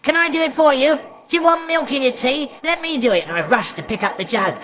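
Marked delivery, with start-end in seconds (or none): 0.0-3.2 high-pitched, keen offer; eager child. Acted. Boy begging to use his new jug, eager. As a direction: jump in eagerly, voice raised high. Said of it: "I'd say".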